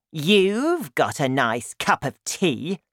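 indignant put-on grandmother; Grandma, crying out. A narrator's reading. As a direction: accuse slowly as a cranky grandmother. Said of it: "she cried".

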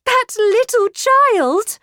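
high, loud, slow cry of disbelief; shrieked. A narrator's reading. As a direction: shriek in outrage, stretching each word. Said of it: "I shrieked".

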